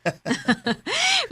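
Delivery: light high-pitched giggle. Acted. Mother laughs at daughter. (taas nga kataw)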